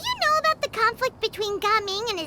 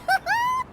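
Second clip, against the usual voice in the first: happy laugh; 12 semitones higher than usual. Acted. Paimon laughs while eating.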